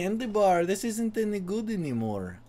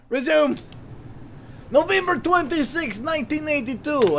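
mock Eastern European accent; 9 semitones higher than usual. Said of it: "going in and out of the accent".

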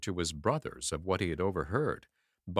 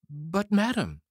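put-on high, loud little boy's plea; pleading boy; 9 semitones higher, 5 dB louder than usual. A narrator's reading. Tom Thumb pleading with the ogre's wife, high and urgent. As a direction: beg the lady urgently.

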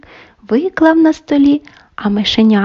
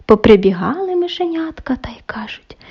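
usual speaking voice

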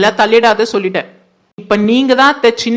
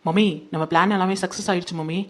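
hushed plotting; 3 semitones lower, 9 dB quieter than usual. Acted. Daughter plots secretly with mother.

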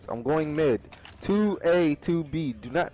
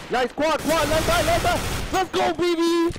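loud high victory cheer; excited cheering; 5 dB louder than usual. Real, not acted. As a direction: shout with joy at the win. Celebrating a won round.

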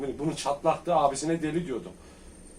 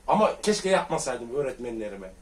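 usual speaking voice

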